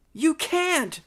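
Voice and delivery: high, urgent protest; pleading. Acted. Mary Ann begging the invaders to stop, pleading. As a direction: plead it, pitched right up. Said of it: "pleaded my sweetheart".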